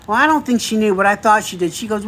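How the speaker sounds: ordinary delivery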